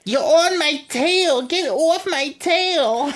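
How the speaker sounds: very high, slow, loud mock squawk; shrieking backing dancer. Imitating the dancer after someone falls over, mock shriek. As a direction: wail it high and drawn out. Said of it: "that woman's like".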